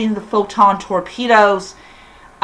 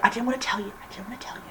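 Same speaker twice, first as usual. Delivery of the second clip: much softer, fading into a whisper; sheepish whisper; 17 dB quieter, much faster than usual. Retold or imitated. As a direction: confess it under your breath. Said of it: "I was like".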